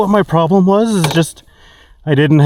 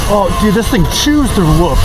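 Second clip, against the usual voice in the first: high, thrilled exclamation; can't believe how good; excited amazement; 5 semitones higher than usual. Real, not acted. Amazed at the suspension.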